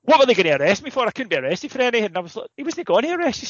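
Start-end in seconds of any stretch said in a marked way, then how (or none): 0.0-2.1 higher, fast and breezy; naive protest. Retold or imitated. Friend protesting he couldn't be arrested, mock naive. 2.6-3.5 much higher exasperated retort. Retold or imitated. Speaker telling naive friend the truth, exasperated.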